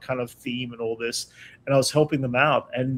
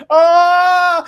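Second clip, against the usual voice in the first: sharp, much higher hammed-up scream of pain; mock scream; 8 dB louder than usual. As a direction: yelp like the saw went through your hand. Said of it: "And I'm like".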